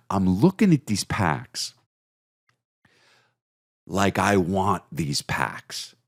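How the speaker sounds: slow, drawn-out covetous voice; longing stare. Shown as a demonstration. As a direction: stretch it out like you crave them. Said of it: "That's how I'm looking at these packs".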